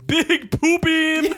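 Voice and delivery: high, slow, drawn-out silly yell; catchphrase yell. Pretend. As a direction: stretch it out high like a goofy battle cry. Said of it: "That's my new catchphrase".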